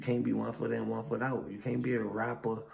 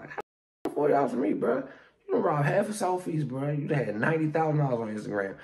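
loud, raised-pitch outrage at a begging friend; indignant rant; 5 semitones higher, 6 dB louder than usual. Pretend. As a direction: tell him off loudly in disbelief. Indignant reply to friend calling from jail.

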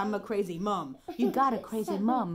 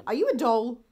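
ordinary talk, nothing marked

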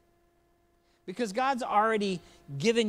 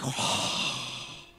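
hushed awe; 8 semitones lower, 4 dB quieter than usual. Retold or imitated. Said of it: "everybody's like".

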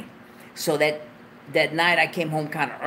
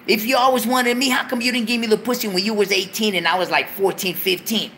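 high, loud incredulous rant; indignant; 8 semitones higher, 3 dB louder than usual. Retold or imitated. To the girl who says she always wanted him, high and loud. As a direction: throw her words back at her. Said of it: "It's like".

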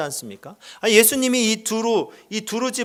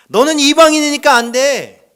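a loud, higher, cold no; stern rejection; 6 semitones higher, 8 dB louder than usual. Retold or imitated. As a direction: refuse her bluntly. Voicing Jesus' rejection. (단호하게 거절하는 목소리)

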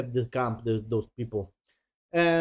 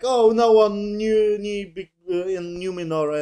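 mock crying; 5 semitones higher, 4 dB louder than usual. Queen lamenting to Galadriel, mock crying. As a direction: imitate a crying woman, hammed up. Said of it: "she was crying like".

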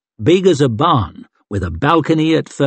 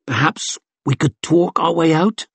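higher, tentative suggestion; tentatively hopeful; 4 semitones higher than usual. A narrator's reading. Thief suggests avoiding a fight, venturing hopefully. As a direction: float it hopefully, a touch unsure. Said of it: "Chev ventured hopefully".

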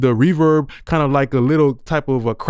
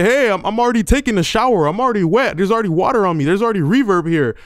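higher playful voice speaking as the beat; beat talking voice; 6 semitones higher than usual. Pretend. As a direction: talk like the beat itself. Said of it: "The two-track beat say".

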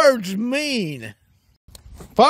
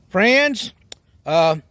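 ordinary talk, nothing marked

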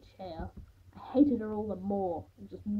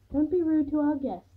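scolding mother; 6 semitones higher, 8 dB louder than usual. A narrator's reading.

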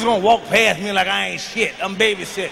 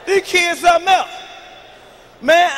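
normal talking voice

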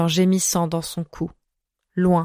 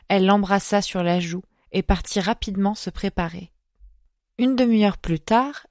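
ordinary delivery, nothing marked

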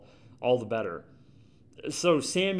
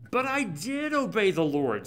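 defensive Saul; 6 semitones higher than usual. Retold or imitated. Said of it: "Saul answered".

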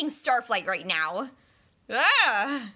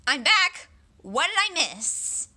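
ordinary talk, nothing marked

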